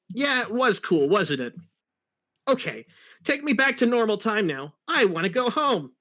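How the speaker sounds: breezy hero wanting to go home; Sonic voice. Acted. Sonic voiced in read fanfic.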